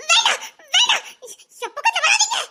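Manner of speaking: high, panicked shout of no; frightened plea. Acted. Girl begs the thugs to stay away, panicked high cry. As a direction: push them away in panic.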